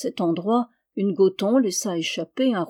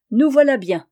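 hearty exclamation; 4 semitones higher, 6 dB louder than usual. A narrator's reading. Monk relieved after wounds dressed.

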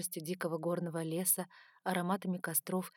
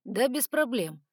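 slow, louder mock agreement; higher, drawn-out sarcastic assent; hint of sarcasm; 5 semitones higher, 7 dB louder, slower than usual. A narrator's reading. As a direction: draw it out with light sarcasm. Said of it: "с ноткой сарказма отозвался он".

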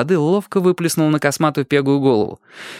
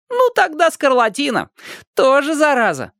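put-on much higher cheeky boy voice; teasing boy. A narrator's reading. Upside-down boy teases the neighbours, high and cheeky. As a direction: needle them like a little brat.

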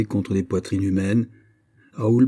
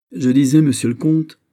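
loud, higher, anger held in check for an equal; colère contenue; 6 semitones higher, 7 dB louder than usual. A narrator's reading. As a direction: say it with anger still showing. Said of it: "avec un accent de colère encore sensible". (colère encore sensible, à peine tempérée)

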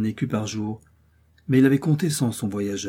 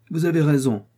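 raised, higher quick agreement; gentleman exclaims; 5 semitones higher, 4 dB louder than usual. A narrator's reading. The gentleman agreeing with Milady before riding off, exclaiming. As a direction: agree sharply, in a hurry. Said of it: "s'écria le gentilhomme".

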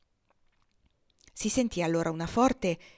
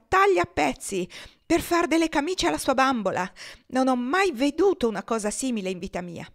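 loud, high angry complaint; exasperated rant; 5 semitones higher, 6 dB louder than usual. Acted. Miss Ophelia complaining to Agostino about Topsy, loud and angry. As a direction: vent every grievance at once.

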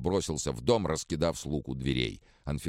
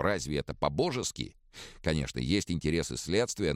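ordinary delivery

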